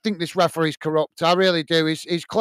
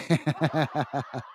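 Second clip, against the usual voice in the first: amused chuckle; 4 semitones lower, 8 dB quieter than usual. Real, not acted. Chat message about hot dogs.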